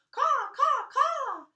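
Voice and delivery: high, loud crow calls; cawing crows. Acted. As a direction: caw like crows overhead.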